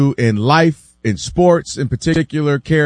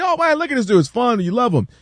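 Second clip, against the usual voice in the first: higher-pitched admiring aside; mock fan delight; 6 semitones higher than usual. Fans watching a young talent learn, mock delight. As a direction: imitate a fan marvelling at a prodigy.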